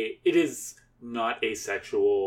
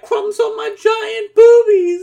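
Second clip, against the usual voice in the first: very high, shouted cartoon squeal; hammed-up shriek building to a yell; cartoon character voice; 12 dB louder than usual. Joking as the gingerbread girl, shrieked cartoon voice. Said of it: "is that that Family Guy character?".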